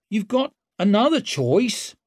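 innocent. A narrator's reading. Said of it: "all innocence".

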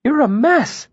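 high-pitched, anxious fussing; worried. A narrator's reading. Bast sees how hurt Kvothe is, worried. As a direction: exclaim it with worry, voice way up.

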